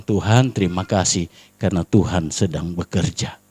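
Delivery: low, soft prayer of thanks; quiet thanksgiving. Retold or imitated. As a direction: thank God under your breath. Doa syukur dalam cerita. (doa syukur lirih)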